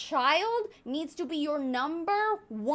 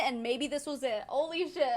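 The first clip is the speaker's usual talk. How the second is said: ordinary talk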